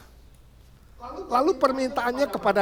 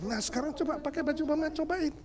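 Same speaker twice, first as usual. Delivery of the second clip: quick, higher coaxing voice; family urging; 8 semitones higher, 8 dB quieter, faster than usual. Acted. Family urging Betty to try the dress, quick and high. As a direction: hurry her to try it on. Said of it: "mereka bilang".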